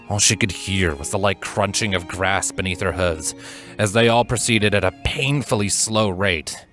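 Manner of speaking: ordinary delivery, nothing marked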